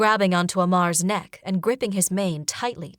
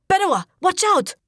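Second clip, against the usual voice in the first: much higher, loud alarmed cry; urgent warning shout; 12 semitones higher, 6 dB louder than usual. Acted. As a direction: yell the warning in panic.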